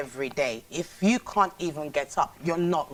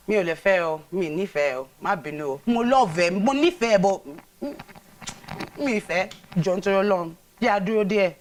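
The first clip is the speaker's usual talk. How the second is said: louder, pleading Yoruba; Yoruba, a pleading refusal; 4 semitones higher, 4 dB louder, faster than usual. Acted. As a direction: beg him to wait, in Yoruba. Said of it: "Yoruba that you say you speak very well".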